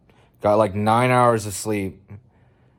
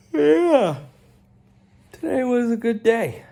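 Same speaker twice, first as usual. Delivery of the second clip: much higher sing-song; sing-song cheer; 12 semitones higher, 3 dB louder than usual. Real, not acted. Vlogger ending the day, high sing-song. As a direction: chirp it happily.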